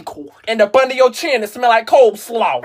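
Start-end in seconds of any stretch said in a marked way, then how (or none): none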